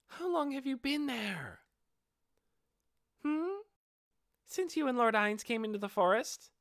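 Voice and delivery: high, bright-voiced young girl; a mischievous kid answering breezily; playful dark elf girl. Aura answering how long she hid, high playful girl.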